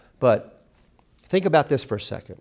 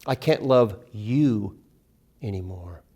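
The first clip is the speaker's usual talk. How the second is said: slow line, "you" held and set apart, "anymore" dropped low; heavy, drawn-out "you"; stress on YOU; 3 semitones lower, 3 dB louder, slower than usual. Shown as a demonstration. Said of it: "Emphasize individual words in the title".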